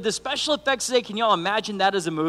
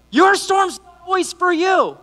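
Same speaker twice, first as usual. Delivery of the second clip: high, drawn-out shout from the pulpit; full-voiced, raised-pitch proclamation; preaching shout; 8 semitones higher, 4 dB louder than usual. Real, not acted. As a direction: shout it slowly to rouse the congregation. Key line repeated to rouse congregation.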